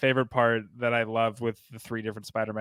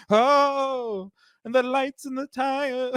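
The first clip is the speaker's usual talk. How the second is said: high mock [name] girl voice; 11 semitones higher, much slower than usual. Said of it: "she's going".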